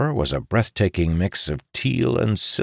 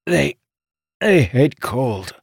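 slow, halting words through pain; strained in pain; 8 semitones higher, 3 dB louder, slower than usual. A narrator's reading. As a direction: push each word out past an injury. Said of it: "between grunts and groans".

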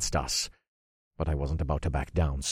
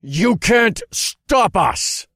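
slow, high, defiant shout; sneering, drawn-out yell; loud sneer; 9 dB louder, much slower than usual. A narrator's reading. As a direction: sneer it back at full volume. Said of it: "I sneered".

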